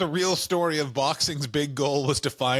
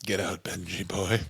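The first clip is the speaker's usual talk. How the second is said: low, quiet mock dismissal; dismissive spouse act; 9 semitones lower, 4 dB quieter than usual. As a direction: play a partner coolly throwing him out. Acted partner throwing husband out.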